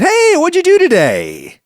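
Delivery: high, loud friendly asking voice; a friend's cheery hey; casual question. Acted. Someone asks the assistant.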